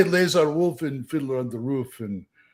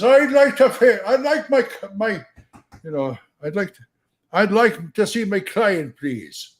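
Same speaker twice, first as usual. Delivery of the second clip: lightly voiced lawyer, higher pitch; higher-pitched, insistent lawyer request; mob lawyer; 8 semitones higher, 5 dB louder than usual. Lawyer asking police to see his client, lightly acted.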